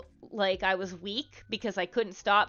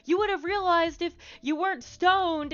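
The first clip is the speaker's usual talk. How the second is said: high, accusing retort; imitated annoyed reproach, pitched up; mock angry cousin; 9 semitones higher than usual. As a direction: act out a cousin's scolding comeback. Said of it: "she got mad".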